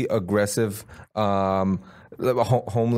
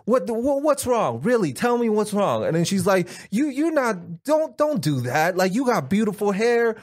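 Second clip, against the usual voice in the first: sass her like a fed-up friend, pitched up; mock scolding friend; 10 semitones higher than usual. Imitating Tamara scolding the homeless lady, high and exasperated.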